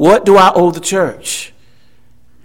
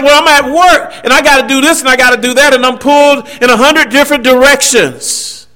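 mock excuse-maker; 6 semitones higher than usual. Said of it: "You said".

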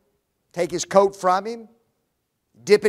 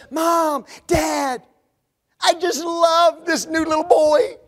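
put-on a young girl gushing about a boy, higher; excited daughter; 7 semitones higher, 3 dB louder, slower than usual. Daughter telling mom and dad about a boy, gushing. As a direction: play the smitten daughter telling her parents.

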